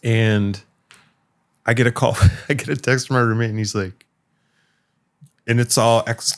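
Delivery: normal talking voice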